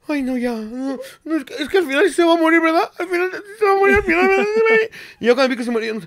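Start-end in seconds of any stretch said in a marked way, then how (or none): 0.1-2.9 high, fast, whimpering imitation of crying; mock crying. Retelling crying at dog video. 3.0-4.4 very high sobbing voice with a real cry; mock sobbing. Retelling crying, fuller sob.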